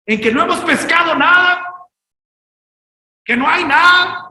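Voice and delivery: rising to a shout; preaching climax. Real, not acted. Preacher crying out about empty nets to his congregation. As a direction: build the lament to full force.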